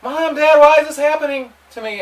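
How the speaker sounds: put-on high, loud pleading child's cry; imitating a child begging its parents. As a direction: wail it like a terrified kid. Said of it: "your children are crying like".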